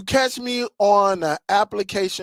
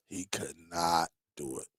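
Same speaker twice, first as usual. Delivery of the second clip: much lower, much softer trailing repeat; fading echo; 14 semitones lower, 13 dB quieter than usual. Real, not acted. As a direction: let the repeat sink away under your breath.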